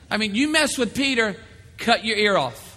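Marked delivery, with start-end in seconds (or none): none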